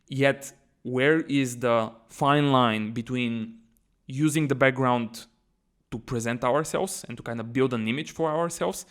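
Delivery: normal talking voice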